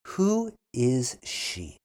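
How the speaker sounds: Who is she? said slowly, Who stressed; slow model. Shown as a demonstration. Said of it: "then repeat slowly".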